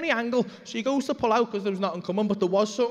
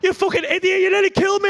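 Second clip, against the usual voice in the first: high, loud outraged yell; fuming; 11 semitones higher, 7 dB louder than usual. Retold or imitated. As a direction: scream it like a furious near-miss cyclist. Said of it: "he was fuming".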